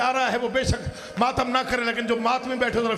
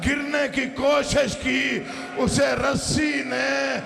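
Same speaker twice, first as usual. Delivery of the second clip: impassioned majlis preaching; 4 semitones higher than usual. Acted. Preacher telling of the exhausted child in Yazid's court, impassioned lament. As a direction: build the grief, stretching key phrases.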